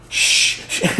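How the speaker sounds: a whispered hushing shh; shushing. Retold or imitated. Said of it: "Chris Hero was like".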